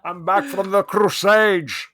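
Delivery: put-on booming, drawn-out Scottish accent, s turned to sh; hammed-up Scottish proclamation; Sean Connery. King Richard home from war, loud slurred Scottish accent. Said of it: "to do my Sean Connery".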